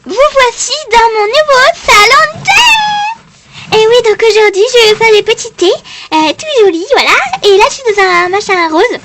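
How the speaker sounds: high, loud, sugary tea salon hostess; hammed-up ad hostess. Hostess greeting viewers in her tea salon, high and loud. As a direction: sell it like a giddy commercial host.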